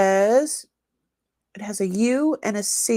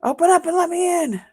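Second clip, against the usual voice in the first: higher, louder call to be let in; mock shouted demand; 11 semitones higher, 6 dB louder than usual. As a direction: call out as if banging on the door. Acts out banging at door.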